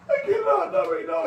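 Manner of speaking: crying refusal. Retold or imitated. Refusing again while crying.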